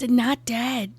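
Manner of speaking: ordinary delivery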